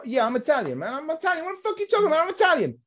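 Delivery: mock a fake Italian, pitch way up; mock Italian-American. Mocking Americans who claim to be Italian, hammed-up high voice. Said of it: "If you sound like that".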